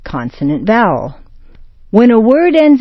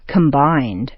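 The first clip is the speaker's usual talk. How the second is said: normal talking voice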